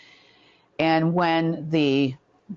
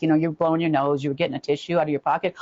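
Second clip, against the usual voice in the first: normal talking voice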